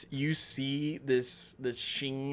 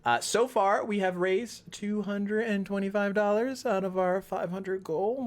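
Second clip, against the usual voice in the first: ordinary talk, nothing marked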